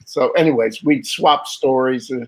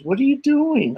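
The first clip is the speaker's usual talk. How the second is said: dad's surprised question; 12 semitones higher than usual. Retold or imitated. Dad finds son at forge.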